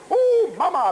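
high, loud hammed-up ooh mama; mock hot-sauce yelp. Reacts to Tabasco.

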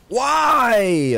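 high loud wail; anguished cry. Real, not acted. Gamer out of stamina, wailing in frustration.

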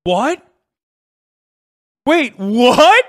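high, loud incredulous question; disbelieving "what", rising sharply; stunned disbelief. Real, not acted. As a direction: react in real shock, pitch jumping up. Stunned by the clip.

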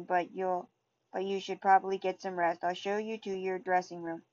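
ordinary delivery

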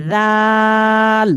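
জাল with the a held very long, slowly; long drawn vowel. Shown as a demonstration. Teacher stretches the vowel for students. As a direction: draw out the জা of জাল. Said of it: "জাতে টান হবে".

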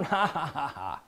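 low, soft string of ha-ha-ha; demonstrated laugh. Retold or imitated. Preacher shows congregation the laugh that comes out in prayer, imitated laughing. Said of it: "there's a laugh that comes out".

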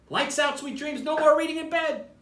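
high, sing-song mama voice; firm but playful lights-out call; Mama telling the monkeys to go to sleep; mama's bedtime order. Acted.

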